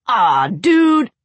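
loud, high disgusted yell. Acted. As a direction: shout it out in disgust. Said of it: "Tucker yelled".

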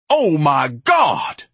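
drawn-out high, loud bellow; exasperated roar, very slow. Acted. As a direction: bellow it in outrage, stretching each word. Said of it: "Ignatius bellowed".

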